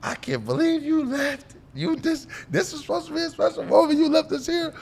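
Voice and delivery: very high-pitched mock-angry mom voice; pissed-off mom. Mom phoning son who left draft night, imitated outrage. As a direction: imitate a mother wailing her complaint way up high. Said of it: "She is pissed".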